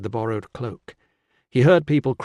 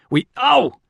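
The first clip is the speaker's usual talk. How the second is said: high, louder yelp of pain; 7 dB louder than usual. Acted. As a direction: break off with a yelp. Injured man, pain interrupts.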